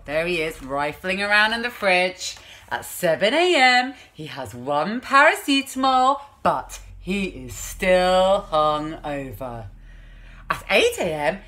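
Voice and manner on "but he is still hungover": but he is still hungover, dragged out slowly; storybook refrain, dragged out. A narrator's reading. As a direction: draw out still like a weary chorus.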